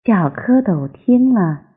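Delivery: ordinary delivery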